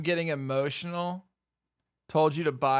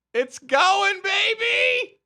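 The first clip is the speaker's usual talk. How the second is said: excited cheer; 5 dB louder, slower than usual. Real, not acted. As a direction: cheer the stock on as it rises. Stock spiking, streamer cheers.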